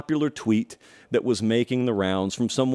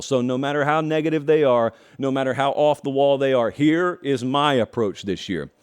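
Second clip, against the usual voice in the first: normal talking voice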